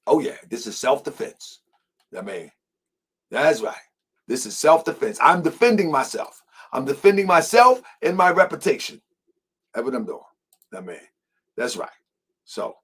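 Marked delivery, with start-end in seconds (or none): none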